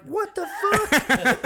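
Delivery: high, drawn-out "what the fuck"; slow, high-pitched mock disbelief; retold disbelief. Stunned reaction to scooter crash.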